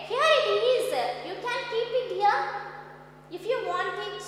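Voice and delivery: loud, high, eager offer; acted friend's offer. Rich friend urging Nasruddin to keep the robe, loud and high. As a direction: boom it out like a generous rich friend. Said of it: "And your wealthy friend said".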